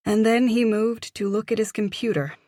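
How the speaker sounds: usual speaking voice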